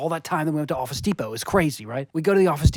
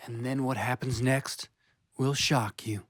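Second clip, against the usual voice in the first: ordinary talk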